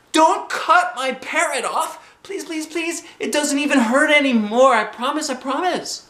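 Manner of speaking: very high, frantic begging; shouted pleading. A narrator's reading. Girl begs Mother to spare swim ring, shouting. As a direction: plead desperately at the top of the range. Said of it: "I shouted".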